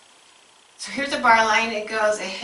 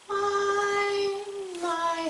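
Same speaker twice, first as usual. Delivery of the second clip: first My high and strong, second My lighter; My sung high, my softer; strong then weak beat; 11 semitones higher, 4 dB quieter than usual. Shown as a demonstration. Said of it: "here's the strong beat, here's the weak beat".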